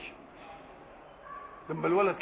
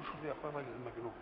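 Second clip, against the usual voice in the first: fast, hushed scoff of a mother; 5 semitones lower, 15 dB quieter, much faster than usual. Retold or imitated. Mother scoffs at a man telling her to throw her son away, fast hushed mutter. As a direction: mutter it fast and dismissively under your breath.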